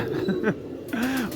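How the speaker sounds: a real laugh, soft and falling away; laughing at himself. Real, not acted. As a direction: laugh at your own silly attempt. Laughing at his own failed catch. (खुद पर हल्की सी हँसी)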